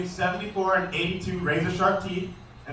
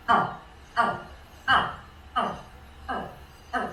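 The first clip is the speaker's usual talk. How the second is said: put-on baby alligator call; 4 semitones higher, 4 dB quieter, slower than usual. Shown as a demonstration. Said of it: "the noise that the baby alligator makes".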